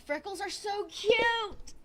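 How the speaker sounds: high, loud, slow gushing; excited squeal. Real, not acted. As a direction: squeal with delight over cute freckles. Squealing over freckles.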